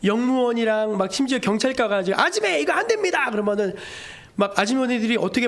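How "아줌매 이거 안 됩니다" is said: high, urgent acted objection; officer protesting, pitched well up; official's warning. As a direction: call out a firm ban to a passenger. Acting officers confronting market women.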